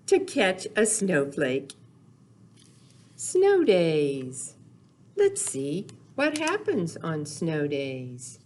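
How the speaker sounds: ordinary talk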